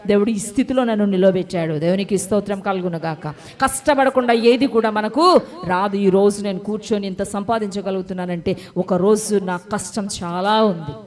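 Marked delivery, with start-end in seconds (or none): none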